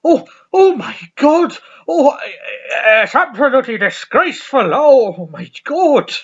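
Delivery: scandalised to the core by what he sees; shocked manager. Retold or imitated. Manager looking at the photos, loudly scandalised. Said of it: "And he's like".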